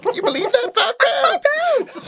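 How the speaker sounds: very high, laughing through the words; high giggling disbelief; laughing squeal. Real, not acted. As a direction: squeal it while cracking up. Joking, cracking up.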